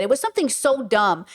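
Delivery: ordinary talk, nothing marked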